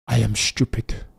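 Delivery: lightly imitated racing driver's accent; a hint of the driver's accent; Charles Leclerc accent. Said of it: "In the Charles Leclerc accent".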